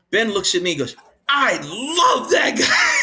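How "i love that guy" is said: high, slow, loud delighted cry; imitated Ben. Quarterback Ben laughing about the security guard, high slow cry. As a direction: crow it out slowly. Ben's reaction, retold.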